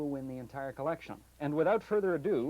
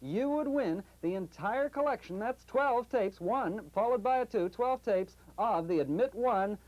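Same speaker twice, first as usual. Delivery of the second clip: higher, emphatic number announcer; raffle caller; 9 semitones higher, 3 dB louder than usual. Acted. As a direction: call the winning number like a raffle.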